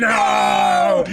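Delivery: high, drawn-out cry of "no"; mock anguished shout. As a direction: wail it in hammed-up dismay. Acts out dismay at fighter falling.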